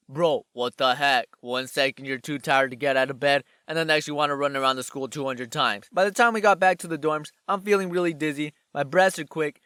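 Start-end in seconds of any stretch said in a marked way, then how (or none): 0.1-5.9 low, put-on complaint; voice of his own body. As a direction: play an exasperated body talking back, pitched down. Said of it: "my body was like".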